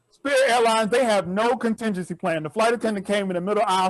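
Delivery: ordinary talk, nothing marked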